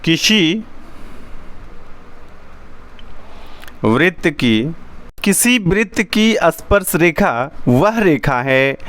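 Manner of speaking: measured phrases with long writing pauses; slow dictation. Shown as a demonstration. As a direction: read out a definition slowly as you write it. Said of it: "परिभाषा मैं लिख रहा हूँ". (लिखते हुए धीरे-धीरे परिभाषा बोलना)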